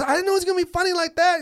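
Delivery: high, rushed defensive protest; flustered excuse. Retold or imitated. Booker defending his line-up, high flustered excuse. Bob defends himself to angry comic.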